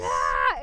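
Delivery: loud, pretend scream of frustration; mock inner scream. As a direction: let out a hammed-up frustrated scream. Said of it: "have the screaming going on inside your head".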